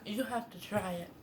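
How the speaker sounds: talking through a mouthful; words around a bite of pie; mouth full. Real, not acted. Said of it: "I just said don't talk with food in your mouth".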